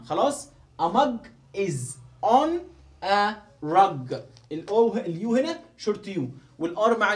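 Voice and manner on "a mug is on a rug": very slow, each word drawn out; slow model reading. Shown as a demonstration. Teacher modelling the sentence very slowly for students. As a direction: read it out word by word. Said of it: "teacher models sentence slowly".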